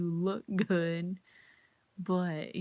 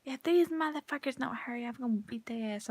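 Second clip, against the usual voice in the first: imitating angry girl; 5 semitones higher than usual. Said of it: "She's like".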